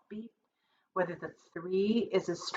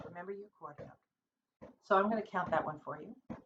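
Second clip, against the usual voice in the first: usual speaking voice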